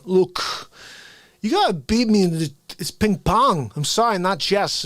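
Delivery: lightly voiced menacing ping pong master; scary Walken guy.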